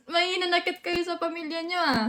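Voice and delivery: ordinary talk, nothing marked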